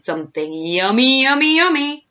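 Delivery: mounting, hungry glee; greedy relish. A narrator's reading. Crocodile relishing his coming meal.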